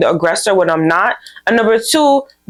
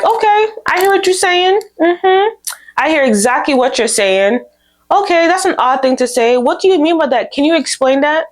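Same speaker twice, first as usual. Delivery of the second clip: higher-pitched, measured composure; composed response; 6 semitones higher than usual. Acted. Answering a coworker's slick remark, composed. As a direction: play unruffled, pitched up from usual talk. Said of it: "when you remain calm".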